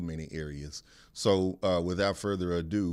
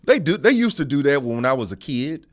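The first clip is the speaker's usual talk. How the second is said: excited explaining; 7 semitones higher, 10 dB louder than usual. Real, not acted.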